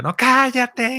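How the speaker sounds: high, loud hammed-up cállate; mock parent yell. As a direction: snap at the child in a mock yell. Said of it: "qué hacemos como papás".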